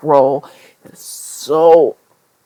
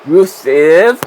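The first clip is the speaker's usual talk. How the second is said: mock Bulgarian accent; 2 semitones higher, 7 dB louder than usual. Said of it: "said that in a fucking accent".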